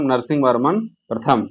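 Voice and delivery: ordinary talk, nothing marked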